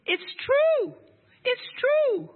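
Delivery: high, loud cry of amazement; amazed disbelief. Retold or imitated. Author telling her husband the book really happened, amazed. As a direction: exclaim it high with the key word stretched out. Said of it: "I couldn't believe it".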